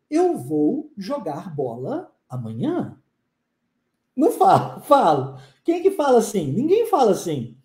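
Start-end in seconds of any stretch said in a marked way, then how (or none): 0.1-3.0 slow, over-careful "eu vou jogar bola amanhã", every word separate; stiff formal Portuguese. Shown as a demonstration. Teacher mocks the refined way nobody speaks. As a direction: read it out pausadamente, stiff. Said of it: "eu não falo com você assim".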